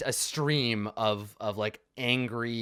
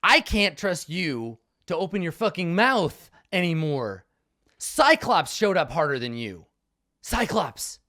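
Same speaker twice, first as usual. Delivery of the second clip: angry yelling; 7 semitones higher, 7 dB louder than usual. Acted. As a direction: tear into her, shouting. Acted quarrel at captive.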